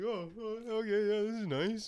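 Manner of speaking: high-pitched musing imitation; mock contented shopper. Imagining a friend browsing cream cheese, high mock musing.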